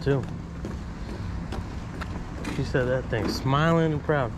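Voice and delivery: ordinary talk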